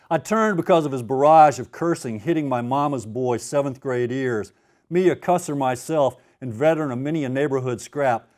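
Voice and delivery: gritty novel reading with momentum; dramatic reading. Acted. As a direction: tell it like a punch is landing. Author reads his novel aloud.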